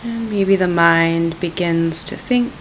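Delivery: calm, unhurried meditation voice; guided meditation. Acted. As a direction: ease them gently into the practice.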